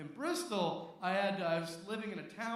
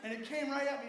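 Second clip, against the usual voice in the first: usual speaking voice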